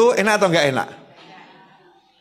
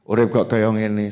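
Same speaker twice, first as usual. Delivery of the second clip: lower, sulky grumble through a sour face; 7 semitones lower than usual. Retold or imitated. Preacher imitates a sulky man moaning, lower and sour. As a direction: mutter it with a scowl. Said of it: "sama wajah yang nggak enak".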